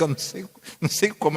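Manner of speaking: normal talking voice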